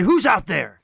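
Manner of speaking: startled call into the dark; startled challenge. Acted. Player's character confronting a noise in the woods, startled shout. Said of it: "spin around and say".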